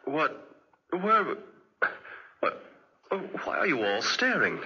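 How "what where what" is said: ordinary talk, nothing marked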